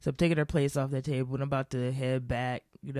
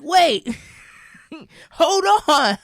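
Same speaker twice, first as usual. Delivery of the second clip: loud, high "wait, hold on" in disbelief; stunned reaction; 13 semitones higher, 11 dB louder, much slower than usual. Retold or imitated. Narrator reacting to friend's boomer joke, loud high disbelief. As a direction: shout it out, thrown by the joke.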